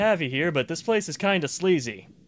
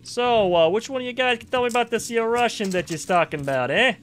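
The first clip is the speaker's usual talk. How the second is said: put-on New York accent; 6 semitones higher than usual. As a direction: ask around like a curious New York tough guy. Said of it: "this New York accent's gonna piss someone off".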